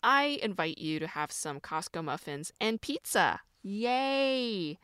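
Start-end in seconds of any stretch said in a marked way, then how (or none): 3.6-4.8 bright ironic yay, higher; a tongue-in-cheek little cheer; mock cheer. Inviting viewers to inflame their joints, mock cheer.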